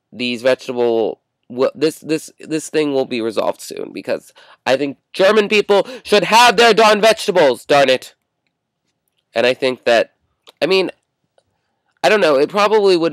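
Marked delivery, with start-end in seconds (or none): 5.1-8.1 loud, high-pitched mock outrage; mock indignant outburst. Vlogger protesting for Germans' right to vegetables, mock indignant. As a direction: declare it loudly like a comic protest.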